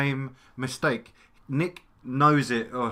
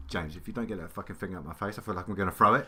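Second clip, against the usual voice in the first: low, hushed threatening mutter; quiet menace; 7 semitones lower, 8 dB quieter, faster than usual. Retold or imitated. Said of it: "I'm really fucking pissed off".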